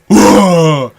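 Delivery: loud, high imitated wrestler yell; Tasmanian devil cry. Telling a friend how the wrestler screamed, Tasmanian devil yell. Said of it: "he sounded like the Tasmanian devil".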